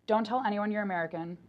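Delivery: normal talking voice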